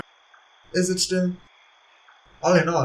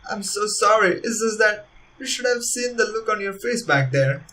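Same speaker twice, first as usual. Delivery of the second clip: put-on amused apologetic girl; 7 semitones higher than usual.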